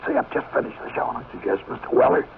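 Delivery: imitating a shot voice; worn-out voice. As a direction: talk like your voice is gone after the show. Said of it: "my voice is still like this".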